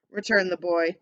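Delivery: ordinary delivery, nothing marked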